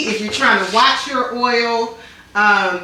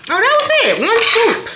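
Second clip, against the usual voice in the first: delighted; 11 semitones higher than usual. Real, not acted. Pleased with a neat move.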